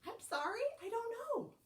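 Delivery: high, lightly voiced apologetic librarian; librarian character. A narrator's reading. Librarian answers cow in story.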